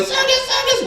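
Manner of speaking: put-on very high excited kid shout; a kid yelling with joy from the back seat; excited little boy. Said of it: "the little boy".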